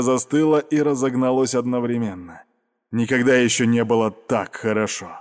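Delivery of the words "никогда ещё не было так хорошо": ordinary delivery, nothing marked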